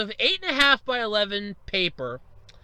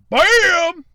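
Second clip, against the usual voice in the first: high, very loud bam; triumphant reveal; 9 semitones higher, 12 dB louder than usual. Acted. Sony revealing price to Microsoft, triumphant shout.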